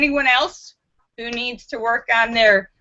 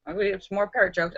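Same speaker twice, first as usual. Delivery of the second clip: usual speaking voice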